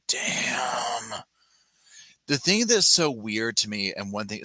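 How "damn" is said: hushed, breathy mock whisper of dismay; whispered curse. Jen realising her father may wake, whispered dismay. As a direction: breathe it out like she's caught. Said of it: "Jen was like".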